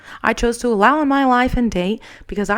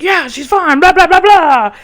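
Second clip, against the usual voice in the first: loud, higher, waving it away; dismissive brush-off; 8 semitones higher, 9 dB louder than usual. Retold or imitated. The ex to a worried bystander, a loud dismissive brush-off. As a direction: brush off the bystander, louder on the blahs. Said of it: "And he's like".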